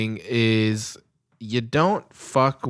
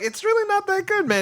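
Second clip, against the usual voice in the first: much higher, mock timid reply; mock squeaky insistence. Tourist insisting to the local, high and mock nervous. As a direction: push back in a squeaky voice. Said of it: "And I'm like".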